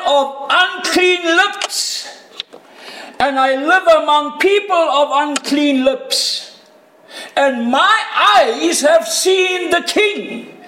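preacher acting out the prophet's anguished cry. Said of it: "He starts to cry out".

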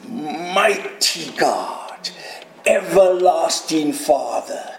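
slow, whispery reverence; hushed awe. Acted. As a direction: draw out each title in an awed whisper. Reverent reading of Messiah's titles.

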